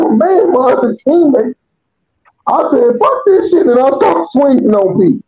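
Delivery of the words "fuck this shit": retold outburst. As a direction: blurt it out high, as in retelling a fight. Retelling his outburst in the fight.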